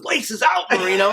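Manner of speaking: high, loud mock call across the field; retold yell. Guest yelling at the quarterback who threw the footballs, a loud high mock yell. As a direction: shout it up and out, like a fan heckling.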